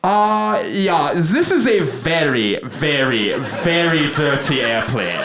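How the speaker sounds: put-on high-pitched announcer with a foreign accent; airport loudspeaker announcer. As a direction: apologize over the PA in accented English. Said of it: "a voice comes over the loudspeaker".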